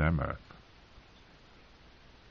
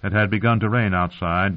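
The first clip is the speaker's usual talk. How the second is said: plain reading voice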